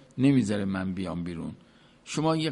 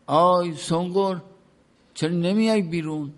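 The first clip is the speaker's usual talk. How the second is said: higher, louder retold call; 6 semitones higher, 4 dB louder than usual. Master at the door calls out to Sonqor inside, in a retold call. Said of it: "یه داد زد".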